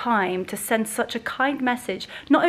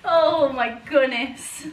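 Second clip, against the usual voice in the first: usual speaking voice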